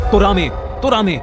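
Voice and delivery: high, tearful frightened cry; scared crying shout. A narrator's reading. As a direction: yell it out in panic, half sobbing. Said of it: "रोती हुई Lara चिल्लाने लगी".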